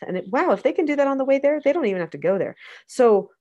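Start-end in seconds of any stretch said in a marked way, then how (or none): none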